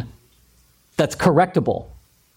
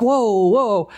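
mock alarm; 4 semitones higher than usual. Other person backing off.

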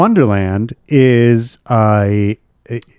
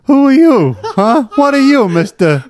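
bully him, cracking up; hammed-up bully, pitched up and laughing; mock tough-guy taunt; 8 semitones higher than usual.

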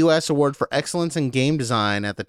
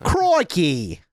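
Crikey, higher, Aussie accent; Aussie exclamation; 5 semitones higher than usual. Shown as a demonstration. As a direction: exclaim it like a bushman. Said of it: "Crikey is like an exclamation".

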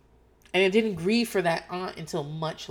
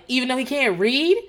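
higher, louder teasing question; a higher, louder snarky comeback; cheeky retort; 7 semitones higher, 8 dB louder than usual. Retold or imitated. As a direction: needle her with it. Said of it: "I was like".

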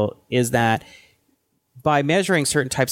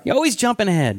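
playful scolding; 8 semitones higher than usual. Real, not acted. Mock scolding of interrupting co-host.

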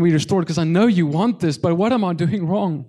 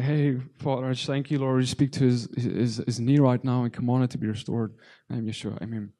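softer, reverent prayer that fades to near silence; hushed prayer; 2 semitones lower, 4 dB quieter than usual. Retold or imitated. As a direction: pray it gently and let it trail off. Retells praying over a knee.

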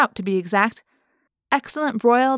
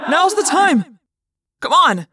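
loud, high urgent command; urgent snap; 8 semitones higher, 7 dB louder, slower than usual. A narrator's reading. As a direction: bark the order to go. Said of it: "Jake snapped".

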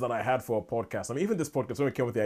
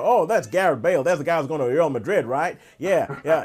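a louder, higher clueless American coach; Ted Lasso; 4 semitones higher, 6 dB louder than usual. Retold or imitated. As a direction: bounce in, sure of a wrong fact. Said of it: "he would be like".

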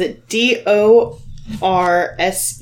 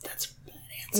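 fast hissed creature whisper; spooky whisper; 17 dB quieter, much faster than usual. Acted. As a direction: whisper it like a disapproving spirit.

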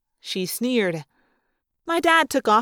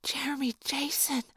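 very soft, hushed awe; breath-held, starstruck hush; awestruck whisper; 2 semitones higher, 10 dB quieter than usual. A narrator's reading. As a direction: whisper the name in wonder. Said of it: "whisper in awestruck tones".

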